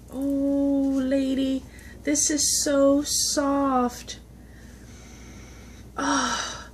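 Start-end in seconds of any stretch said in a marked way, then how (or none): none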